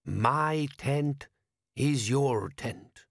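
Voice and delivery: put-on slow, accented, ceremonious elder voice; desert wise man. A narrator's reading. Wise man answers his foreign guest, slow and stately. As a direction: intone it slowly as a foreign sage.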